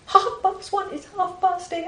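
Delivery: a high, outraged young doctor, mock-imitated; indignant doctor. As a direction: ape his shocked disbelief, pitched well up. Angry young doctor, retold.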